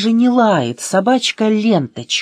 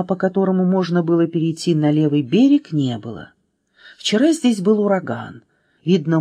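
ordinary delivery, nothing marked

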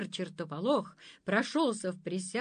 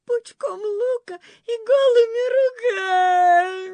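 much higher, louder crying howl; full-voiced wail; 12 dB louder, faster than usual. Zlyuchka answering the monster, wailing at full voice. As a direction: bawl it at full voice. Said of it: "заревела во весь голос".

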